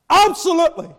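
loud, high, punched absolutely; emphatic shout. Acted. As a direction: slam the answer down. Preacher affirms emphatically.

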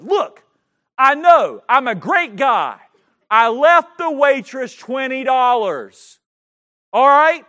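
loud, slow, emphatic retort; exasperated. Retold or imitated. Husband replays telling wife off in the car, exasperated. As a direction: lay it out loudly, word by word.

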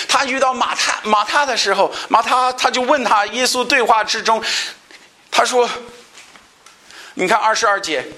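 high, intense sermon voice; impassioned pulpit delivery; fervent preaching. Acted. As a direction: preach it out with rising fervor. Preaching at raised pitch.

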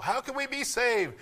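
preaching cry. Acted.

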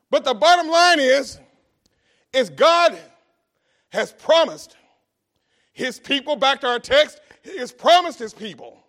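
loud, rising preacher's cry; preaching at full force. Real, not acted. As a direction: preach it out to the congregation.

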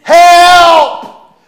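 re-enacted panicked shout, long and high; cry for help. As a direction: yell it loud and drawn out. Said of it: "as I'm doing it, I'm saying".

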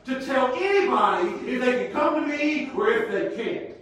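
loud, high, stern rebuke; Jesus rebuking. Acted. Jesus rebuking the disciples, loud and stern. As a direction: scold them with full force. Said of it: "He looks at them and he says".

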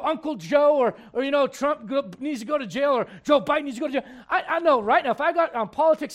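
ordinary talk

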